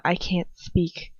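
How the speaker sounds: ordinary delivery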